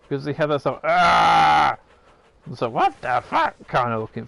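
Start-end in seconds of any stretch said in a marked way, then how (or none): 0.8-1.8 mock startled gasp. Acting out a character's face. 2.7-3.5 high, startled outburst; mock shocked what the fuck. Acting out a character's face.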